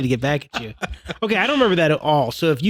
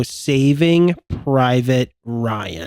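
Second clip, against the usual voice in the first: slow; 3 semitones lower, much slower than usual. Shown as a demonstration. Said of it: "can you do it slower".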